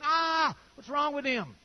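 high pained "Ah!" then a hurt question; the grieved Spirit reacts in dismay; dismayed cry. Pretend. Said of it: "you can hear the Holy Spirit going".

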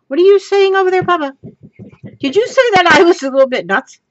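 high, loud, quickening mock indignation; playful challenge. Real, not acted. Crafter asking Papa if he called her nuts, playful high challenge. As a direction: call across the room, playfully affronted.

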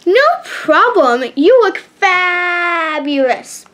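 slow, high, gushing compliment; swinging mirror's voice. A narrator's reading. Shakira replying to her friend's thanks, gushing like the mirror. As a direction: stretch it out high like a fawning talking mirror. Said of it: "sounding just like the swinging mirror".

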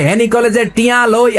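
imitated protest slogan with H for F; mock procession slogan. Preacher mimics marchers at Feni college, mock slogan chanting. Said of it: "মিছিল দেয়".

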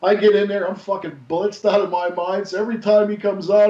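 ordinary talk, nothing marked

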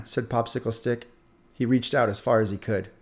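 ordinary delivery

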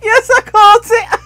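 high voice breaking into laughter; laughing. Real, not acted. Player admitting they caught the joke, laughing. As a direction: answer through your laughter.

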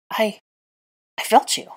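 halting start with a pause after I; stammering. A narrator's reading. Witch just arrived in bewilderment, stammering. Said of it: "she stammered".

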